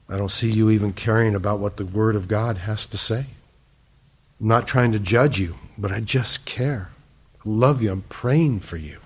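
soft, low, tender appeal; loving concern. Retold or imitated. Preacher confronting his brother about salvation, lovingly and softly. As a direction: gently plead out of love, keeping it quiet.

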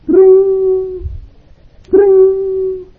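put-on high, loud ringing "trin" like an old phone; phone ring imitation. Preacher imitating the phone ringing late at night, high ringing call. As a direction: ring it out like a telephone. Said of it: "comienza a sonar el teléfono".

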